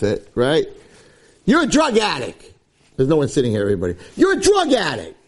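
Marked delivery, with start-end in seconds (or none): 1.5-2.5 high-pitched mock accusation; hammed-up finger-pointing charge; accusing. Shown as a demonstration. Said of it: "don't accuse". 4.2-5.2 very high, hammed-up accusation; exaggerated accusing outburst for demonstration. Shown as a demonstration. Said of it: "don't accuse".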